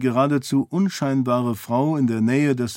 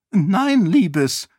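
much higher, breathless prattle; high chattering queen; 6 semitones higher than usual. A narrator's reading. Queen prattles to Sarene about roses in a high voice. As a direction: babble on happily about the roses. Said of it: "sagte Eshen mit hoher Stimme".

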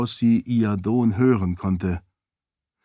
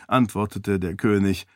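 usual speaking voice

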